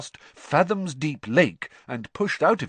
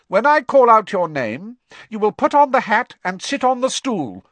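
loud, high-pitched, commanding teacher; teacher addressing pupils; 12 semitones higher, 9 dB louder than usual. A narrator's reading. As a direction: project instructions to a hall of new pupils. Teacher instructs assembled first years.

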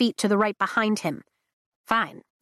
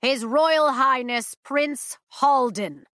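slow, grand herald announcement; royal herald; 5 semitones higher, slower than usual. Acted. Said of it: "A royal herald puffs out his chest".

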